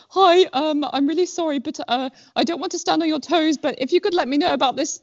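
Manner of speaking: high, tentative mock pleading; pathetically apologetic. Quoting own precarious first message, pathetically apologetic. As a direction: imitate yourself timidly excusing the intrusion. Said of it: "pathetically worded thing".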